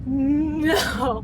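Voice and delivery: drawn-out word through real tears; crying. Real, not acted. Wife refusing the thought about husband, crying. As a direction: stretch it into a sob. Breaks down recalling her thought.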